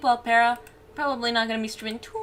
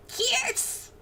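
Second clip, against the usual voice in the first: much higher call of "Kierce!". Real, not acted. Streamer calling out friend Kierce in chat, high call. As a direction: yell his name across the room. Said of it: "I'm calling you out".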